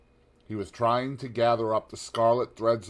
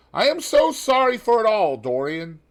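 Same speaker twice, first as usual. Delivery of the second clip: high, loud gentleman's voice, full of sympathy; Lord Henry; 13 semitones higher, 11 dB louder than usual. A narrator's reading. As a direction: lift the voice high and pour out the condolence. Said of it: "said Lord Henry as he entered".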